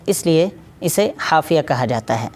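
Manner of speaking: ordinary talk, nothing marked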